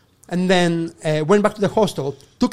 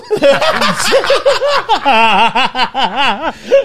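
loud, high burst of laughter; 8 semitones higher, 7 dB louder than usual. Real, not acted. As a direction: crack up at your own joke. Laughs at his own punchline.

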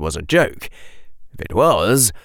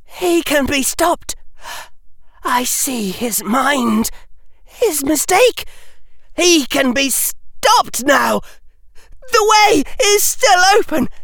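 high, slow, desperate declarations; frantic seer calling out a vision, words stretched; urgent gasping cries. A narrator's reading. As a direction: rise from a high plea to loud cries. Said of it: "his voice lower, but still loud enough".

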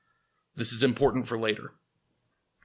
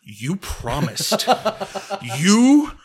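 loud, higher, laughing indignant rant; outraged rant; 3 semitones higher, 8 dB louder than usual. Retold or imitated. Ranting at John for breaking his promise, outraged. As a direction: accuse him like he betrayed you.

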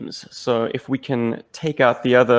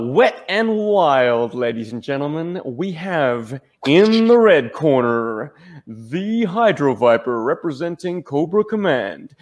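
slow, drawn-out ring announcer; boxing ring announcer; 3 semitones higher than usual. Acted. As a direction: announce the fighters like a title bout. Death battle announcement, red corner.

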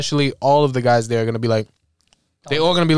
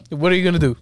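ordinary talk, nothing marked

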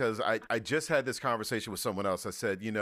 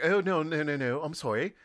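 higher, light mock posh British apology; mock posh accent; 5 semitones higher than usual. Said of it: "trying to even talk posh".

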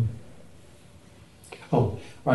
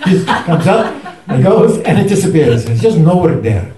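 put-on Indian accent; 4 semitones higher, 4 dB louder than usual. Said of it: "in his Indian accent".

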